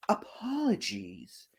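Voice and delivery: soft unvoiced whisper; whispered apology. Playful apology to viewers.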